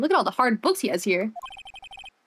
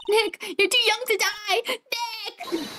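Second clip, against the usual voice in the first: mock wail; 9 semitones higher, slower than usual. Voicing grief over fallen Nick.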